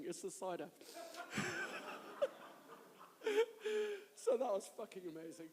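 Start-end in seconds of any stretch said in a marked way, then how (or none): 0.7-2.7 long, high, wheezy laugh; helpless laughter. Real, not acted. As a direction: laugh till you cry, breath running out. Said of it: "now I'm both laughing and crying".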